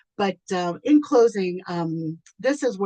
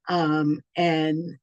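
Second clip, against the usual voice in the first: normal talking voice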